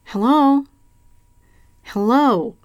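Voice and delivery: small, high voice from a dropped phone; high little voice at the far end of the line; tiny phone voice. A narrator's reading. Voice squeaking up from the dropped phone, small and high. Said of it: "said the midget voice from the dropped telephone".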